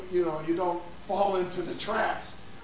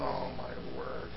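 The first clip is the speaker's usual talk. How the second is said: low, slow, hushed dread; hushed fearful gasp; 8 semitones lower, 13 dB quieter, slower than usual. Retold or imitated. Preacher retelling his fear on the subway, hushed and slow. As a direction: breathe it out as if facing death.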